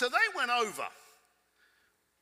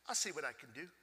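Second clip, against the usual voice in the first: reluctant mumble; 4 semitones lower, 9 dB quieter than usual. Retold or imitated. Curator's reluctant reply, low.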